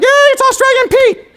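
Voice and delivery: very high, loud mock squeal of delight; cheering kids. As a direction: cheer like a classroom of thrilled children. Imitated kids cheering for guest reader.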